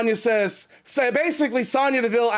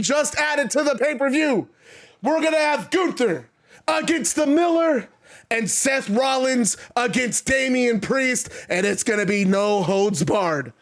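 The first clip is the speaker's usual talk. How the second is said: ordinary delivery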